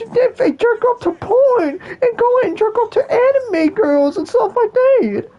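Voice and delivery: very high, slow, laughing falsetto whine; whiny woman parody. Retold or imitated. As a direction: ham up a woman crying online. Mocking women on social media.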